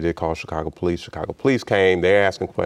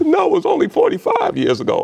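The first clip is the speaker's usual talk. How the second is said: very high, loud laughing correction; laughing reply; 6 dB louder than usual. Retold or imitated. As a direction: laugh it out in joy.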